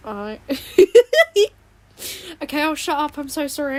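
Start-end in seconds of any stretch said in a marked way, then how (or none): 0.4-1.5 sudden high loud burst of laughter; squeaky laugh that bursts out; odd laugh. Real, not acted. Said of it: "I don't know why I laugh like that".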